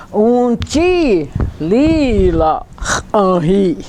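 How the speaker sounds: "Unchi lila ahi" drawn out word by word; slow careful model. Shown as a demonstration. Teacher showing how it's written, slow careful pronunciation. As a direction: stretch each syllable of "Unchi lila ahi". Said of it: "See how it's written?".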